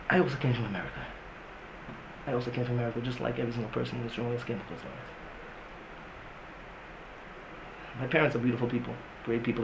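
low, soft, intimate lecturing; subdued voice, dropping near a murmur; hushed confessional. Real, not acted. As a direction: confide it quietly to the room. Hushed personal confession to the room.

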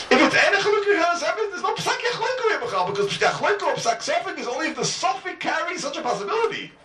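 very high, singsong Talmudic insistence; impassioned arguing. Real, not acted. Teacher proving the Rosh's rule, high-pitched and impassioned. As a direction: drive the point home at the top of your range. Teacher heatedly driving home the argument.

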